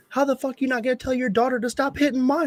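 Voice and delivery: high-pitched, laughing outraged comeback; mock outrage at the other parent; incredulous angry rant. Retold angry comeback to mom.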